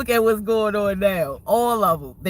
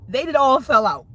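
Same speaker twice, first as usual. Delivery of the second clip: gloating; 6 semitones higher, 6 dB louder than usual. Real, not acted. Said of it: "repeated louder".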